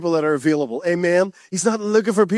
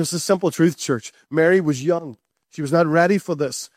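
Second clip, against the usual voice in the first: ordinary talk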